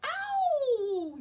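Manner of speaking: high loud yelp of pain; yowling. A narrator's reading. Baby Bear yowling at hot breakfast. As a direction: yelp like a burnt mouth. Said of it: "yowled Baby Bear".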